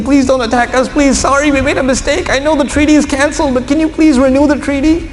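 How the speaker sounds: high-pitched pleading, climbing on the last pleases; hammed-up plea for mercy; begging and pleading. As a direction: beg desperately, voice rising to a squeak at the end. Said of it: "He's begging and pleading".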